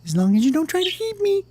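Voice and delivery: quick, very high falsetto; little worm voice. Acted. Worm sets his condition to bird, squeaky and quick. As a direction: squeak it out rapidly.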